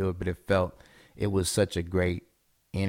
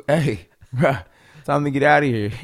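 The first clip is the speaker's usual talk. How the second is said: put-on raised, higher-pitched call to a kid, amused; 7 semitones higher, 8 dB louder than usual. Dad hailing his spaced-out son after school, calling out. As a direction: call out to get his attention, chuckling between calls.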